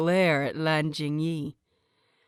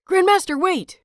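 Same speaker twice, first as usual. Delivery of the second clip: much higher and louder plea; urgent cry; 11 semitones higher, 7 dB louder than usual. A narrator's reading. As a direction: throw yourself in his path with your voice. Student darts in to stop him.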